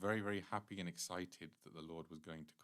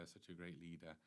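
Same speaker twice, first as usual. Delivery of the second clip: the same gushing words echoed in a fading, much softer voice; a sarcastic afterthought, far softer; mock praise repeated, much softer; 2 semitones lower, 13 dB quieter than usual. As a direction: let the mocking echo die away softly. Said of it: "He's praised like anything".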